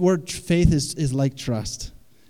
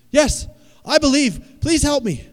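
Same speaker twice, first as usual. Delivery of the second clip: desperate man pleading; 12 semitones higher, 6 dB louder than usual. Acted. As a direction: shout back begging to be rescued. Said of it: "The man responded".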